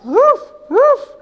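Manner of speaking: put-on high playful woof for a child; dog bark imitation. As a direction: bark like a puppy. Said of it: "The dog barks".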